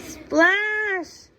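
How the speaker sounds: high bright splash call; playful splash noise for a child; splash sound effect. Acted. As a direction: pop the splash word up high. Sound effect in story reading.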